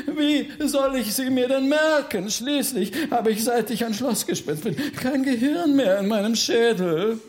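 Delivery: indignant ghost baron. Acted. Baron rebuffs Bertha's advice to sing it, indignant. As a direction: protest in high-pitched exasperation. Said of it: "gleich so ungehalten zu werden".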